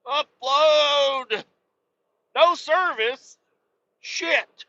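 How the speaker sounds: high, loud frantic cries at the phone; panicking as the upload fails. Pretend. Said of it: "be like".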